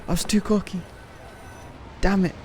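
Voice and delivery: usual speaking voice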